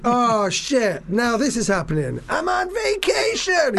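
mock McClane. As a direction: build from annoyed to a high complaining wail.

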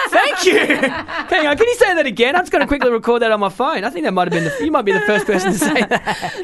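delighted laughing, high and loud. Real, not acted. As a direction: laugh through the words in disbelief. Laughing at being called masculine.

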